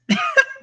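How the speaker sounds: a high laugh through tears; moved to tears by her mom, a short high laugh; teary laugh. Real, not acted. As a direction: laugh off the emotion. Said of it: "This is what Mom does to me".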